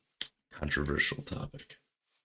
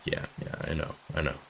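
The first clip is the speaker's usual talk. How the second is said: hushed, smug yeah yeah; smug brush-off; 4 dB quieter than usual. Retold or imitated. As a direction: shrug it off like it's obvious. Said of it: "I'd be like".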